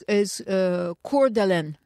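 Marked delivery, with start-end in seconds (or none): 1.0-1.8 "Coeur d'Alene" said "kor-duh-LEN"; English pronunciation. Shown as a demonstration. Answering how it's said in English, "kor-duh-LEN". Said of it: "how you say that in English".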